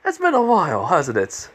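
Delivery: high-pitched acted greeting; voiced greeting. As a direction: greet in a high, falling storyteller's character voice. Storyteller voices greeting in dialogue.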